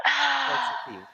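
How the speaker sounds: high character voice. Acted. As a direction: squeak out the offer. Character offering tea again.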